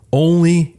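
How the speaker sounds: ONLY stretched high in emphasis; all-caps emphasis. Retold or imitated. Host quoting the president's post, all-caps emphasis on ONLY. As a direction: read ONLY out as if in capitals. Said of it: "you know, all caps".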